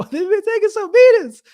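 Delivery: comic falsetto jab at the British fighters; mock high voice. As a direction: squeal it out to poke fun. Mocking British fighters, laughing.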